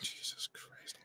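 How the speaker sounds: sigh, then whisper it in despair; a weary whispered curse; sighed, exhausted whisper. Real, not acted. Despairing at the article's claims.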